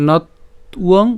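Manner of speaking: measured Sanskrit words with a pause; careful chant-like model reading; verse recitation. Shown as a demonstration. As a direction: recite deliberately with a break after the first syllable. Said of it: "আবার যদি প্রথম থেকে বলি".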